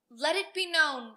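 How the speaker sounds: loud herald's announcement; proclamation. Acted. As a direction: announce it to the whole land. Royal proclamation read.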